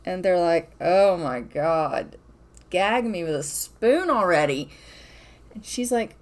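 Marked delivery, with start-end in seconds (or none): 0.8-4.7 slow, low mock groan of disgust; grossed-out teens. Kids sickened by lovey-dovey parents, mock disgust. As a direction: drag it out like an embarrassed kid.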